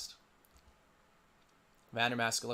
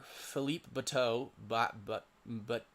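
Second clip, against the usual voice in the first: normal talking voice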